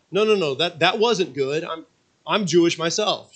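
high, loud flustered protest; horrified stammer. Retold or imitated. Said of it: "she stammered".

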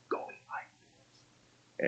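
slow, voiceless whisper; silent-signal whisper. Retold or imitated. Wife signalling the actor from the front row, whispered. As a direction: mouth it almost soundlessly.

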